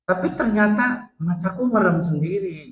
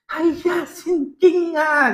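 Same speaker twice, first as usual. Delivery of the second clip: much higher, drawn-out mama cry; high mock mother; 11 semitones higher, much slower than usual. Mama finding her son asleep at prayer, high and drawn out. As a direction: squeal it like a startled mother. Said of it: "kata mama".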